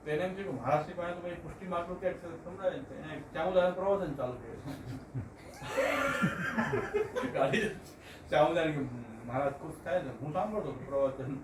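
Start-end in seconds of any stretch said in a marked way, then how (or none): 4.7-7.3 high-pitched burst of laughter; real laugh. Real, not acted. Speaker cracks up telling of the sermon in the car, high laughter. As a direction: laugh out high at your own story. Laughing at his own anecdote.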